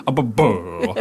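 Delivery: imitating a husky's Italian-sounding babble; husky with Italian accent. Retelling a funny video to cohosts, mock husky babble. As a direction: mimic the dog's garbled Italian vocalizing. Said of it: "the husky just goes".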